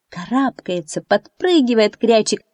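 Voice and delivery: plain reading voice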